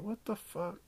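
a stunned high squeak of disbelief; shocked. Retold or imitated. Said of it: "I was like".

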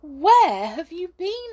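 high, loud astonished question. Acted. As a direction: ask in disbelief. Hop reappears from basket.

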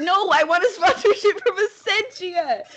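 high, indignant over-the-top protest; mock outrage. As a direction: refuse with theatrical indignation. Mock outrage at rival water sponsorship.

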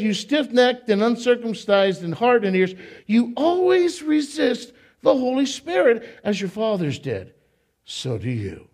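fiery sermon reading of "You stiff-necked and uncircumcised"; preached scripture quote. Acted. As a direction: preach it at the crowd like Stephen. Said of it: "I love the way he talks".

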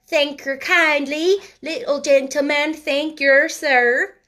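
put-on high, loud beggar child's thanks; imitated beggar girl. Sister imitating how a beggar would thank, mock beggar voice. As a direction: mimic a street beggar's singsong gratitude. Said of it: "She would have said".

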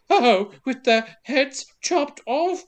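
high, slow, prissy period voice; mock Johnny Depp. Podcaster as the detective hearing of beheadings, mock-dainty voice. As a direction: imitate a squeamish posh investigator. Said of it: "And then Depp's like".

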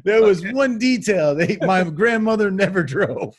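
giggling through the words; laughing while talking. Real, not acted. Laughing retelling.

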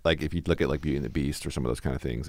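normal talking voice

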